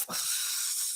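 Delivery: long soft exhale, no voice; acted sigh. Shopper about to give up on the errand, acted long sigh. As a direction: let out a put-upon breath. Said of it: "I normally would have probably been like".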